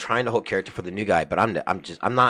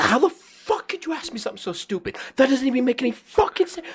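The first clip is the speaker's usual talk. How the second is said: very high, strained retold rant; yelling at nothing. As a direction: re-enact a furious rant at empty air. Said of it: "just yelling at nothing".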